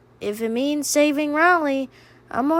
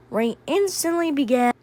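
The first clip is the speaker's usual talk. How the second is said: ordinary delivery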